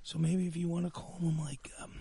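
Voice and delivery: soft, low confidential murmur; hushed secret talk. Acted. Partner quietly suggests a name for the new man, hushed. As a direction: keep it low and private, hesitant.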